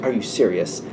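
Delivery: low, soft mock disbelief; disbelieving. Worried friend to the flippant one, quietly disbelieving. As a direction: question them under your breath, deadpan.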